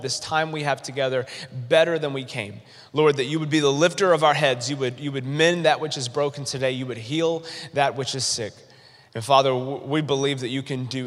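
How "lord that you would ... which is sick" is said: usual speaking voice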